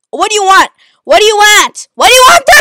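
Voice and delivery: a raw, high scream at full volume. Real, not acted. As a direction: scream it at the enemy. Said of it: "hear me screaming".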